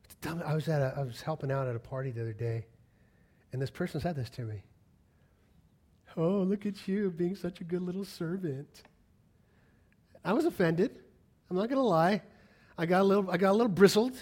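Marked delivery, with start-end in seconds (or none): 6.2-8.8 mock-sweet condescension; patronizing praise. Party guest belittling the helper, patronizing. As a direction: coo it like praise for a small child.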